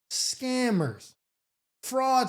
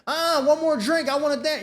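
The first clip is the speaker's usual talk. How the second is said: put-on whiny tipsy girlfriend; 7 semitones higher, 3 dB louder than usual. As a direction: beg to stay at the party like a tipsy girlfriend. Acted quarrel, tipsy girlfriend.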